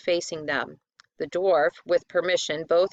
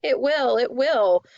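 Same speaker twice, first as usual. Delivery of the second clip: wailing; 6 semitones higher, 4 dB louder than usual. A narrator's reading. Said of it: "wailed and whined".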